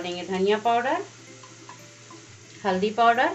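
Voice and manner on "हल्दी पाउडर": ordinary delivery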